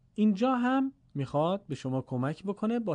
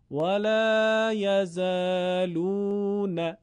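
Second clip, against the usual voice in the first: slow, higher sustained chant; Quranic recitation; 4 semitones higher, much slower than usual. Shown as a demonstration. Teacher shows the example, slow Quranic chanting. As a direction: chant it with long, held vowels. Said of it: "مثال رو ببینید".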